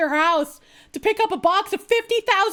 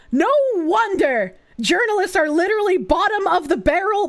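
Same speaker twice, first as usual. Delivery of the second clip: ordinary talk, nothing marked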